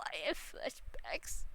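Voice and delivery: ordinary talk, nothing marked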